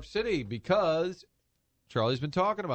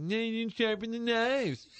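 high, slow nagging wife voice; Jess voice; 9 semitones higher than usual. Retold or imitated. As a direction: nag about the dull knives in a shrill-pitched voice. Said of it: "it totally sounds like you did my Jess voice".

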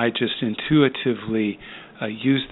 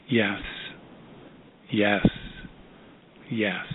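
low, slow single words with long pauses; retold inner yes, spaced out and subdued; inner mantra; 3 semitones lower, 6 dB quieter than usual. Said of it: "she kept saying in her head".

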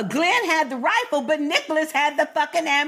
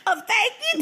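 normal talking voice

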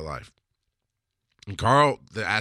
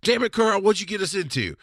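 higher, louder exasperated office-worker cry; mock exasperation; 10 semitones higher, 3 dB louder than usual. Coworkers poking heads out of cubicles at Carl, mock exasperated higher louder cry. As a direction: play the annoyed coworkers calling out from their cubicles. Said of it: "stick their heads out of the, the cubicles and go".

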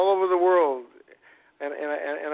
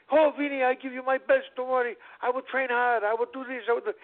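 put-on eager little boy's voice, pitched higher; enthusiastic kid; 8 semitones higher than usual. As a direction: play the kid, all eager promises. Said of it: "he was so enthusiastic about it".

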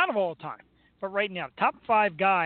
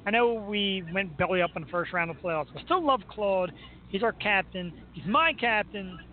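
ordinary talk, nothing marked

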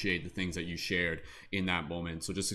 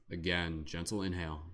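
guided meditation. Acted. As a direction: lead the group gently into calm breathing.

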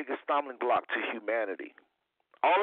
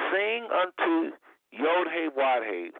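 scripture reading; 4 semitones higher, slower than usual. Acted. Reads scripture slowly.

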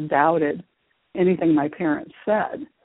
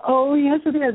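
put-on higher and louder, a child standing her ground; insistent child; 8 semitones higher, 4 dB louder than usual. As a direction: push back hard like an eager little girl. Child insisting against her mother.

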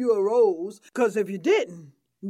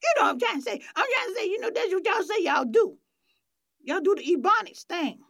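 high, put-on proper voice; talking proper; 10 semitones higher, faster than usual. Radio guest fumbling to explain herself, talking proper. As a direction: keep up the affected, high-pitched proper talk. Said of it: "this is proper".

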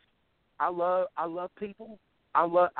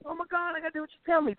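mocking whine; 10 semitones higher, faster than usual. Mocking fake people he hated.

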